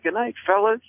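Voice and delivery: put-on polite old man saying goodnight, pitched well up; mock cheery old-timer farewell; old veteran voice. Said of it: "He goes, he's like".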